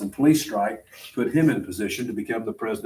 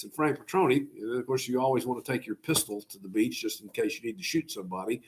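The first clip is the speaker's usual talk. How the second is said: ordinary delivery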